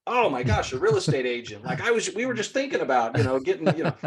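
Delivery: mock delight, pitched high; exaggerated joyful surprise, voice climbing high; happy to see you. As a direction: gush like a thrilled homeowner, hammed up. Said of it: "people are happy to see you".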